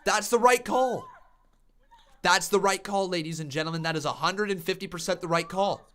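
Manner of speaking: high, loud wrestling commentator; excited play-by-play. Real, not acted. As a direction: shout the call like a ringside announcer. Live wrestling play-by-play call.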